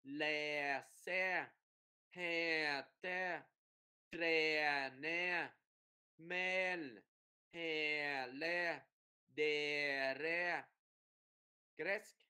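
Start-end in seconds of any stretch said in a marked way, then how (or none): 0.0-10.6 long drawn-out "e" in each word, syllable by syllable; kéo dài vần e. Shown as a demonstration. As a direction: stretch the vowel "e" slowly and evenly. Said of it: "kéo dài vần e". (kéo dài âm "e" thật rõ)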